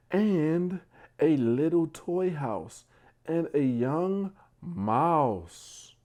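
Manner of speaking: drawing out each rhyming line; bedtime story reading. Acted. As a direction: read it out like a picture book for little ones. Reads bedtime story aloud.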